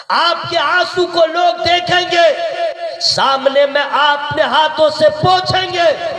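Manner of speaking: high, forceful sermon delivery; fervent preaching. Real, not acted. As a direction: preach it out to the whole crowd.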